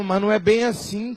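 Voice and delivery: usual speaking voice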